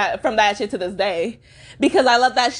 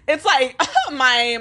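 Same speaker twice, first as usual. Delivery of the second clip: coughing through the words; 3 semitones higher, much slower than usual. Real, not acted. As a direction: voice catching on a cough. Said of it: "my throat doesn't wanna say the bullshit".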